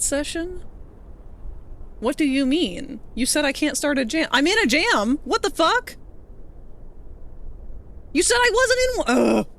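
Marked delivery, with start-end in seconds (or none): 4.3-9.5 rising, high exasperated yelling; indignant outburst. Real, not acted. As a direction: shout in disbelief.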